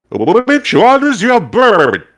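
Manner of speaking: high, slow, burbling creature voice; gelatinous goo voice. Acted. Goo shopkeeper asking a customer about her bird, in a high slow blob voice. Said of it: "He goes".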